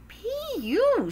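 very high, stretched pee-you; disgusted exclamation. Acted. Frogalina smells Froggy's aftershave, disgusted. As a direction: squeal it at a bad smell.

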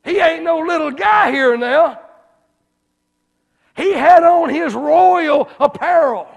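high, loud, laughing preacher build; preaching at full force. Acted. Preacher describing the royal eunuch to the crowd, booming. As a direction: paint the rich man big and loud.

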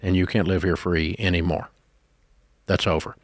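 low, measured, calm ultimatum; calm firmness. Acted. Brother confronting brother over the shared house, very calm and firm. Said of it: "do this very calmly and just say".

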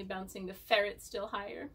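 ordinary talk

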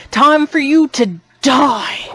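loud playful threat; mock battle cry. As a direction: yell it like a hammy villain. Attacking a practice dummy.

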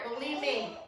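ordinary delivery

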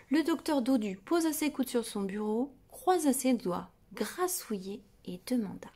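ordinary delivery

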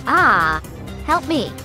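high terrified scream; scream for help. Acted. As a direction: panic as you're seized. Character screams when grabbed.

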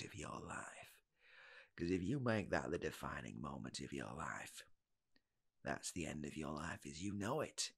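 put-on weary old mentor. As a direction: mourn it like a ruined life. Old man's grave warning.

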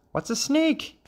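put-on very high childlike question; curious frog child. A narrator's reading. Frog children to their father, high puzzled question. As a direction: ask in a tiny high frog voice.

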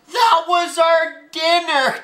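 high, dismayed dad; dismayed protest. Acted. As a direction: protest over the ruined dinner. Daddy protests over ruined dinner.

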